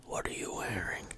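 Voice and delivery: hushed creepy whisper. Acted.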